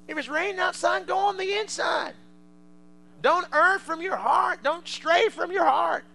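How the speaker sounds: loud, high, slow pulpit cry; preaching at full force. Acted. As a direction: drive the point home from the pulpit.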